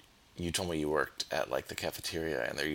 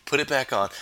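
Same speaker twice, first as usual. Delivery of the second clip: higher, louder coach command; barking gym coach; 6 semitones higher, 7 dB louder than usual. Retold or imitated. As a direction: bark the order at him. Coach yelling to put song back.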